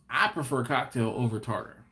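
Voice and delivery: ordinary talk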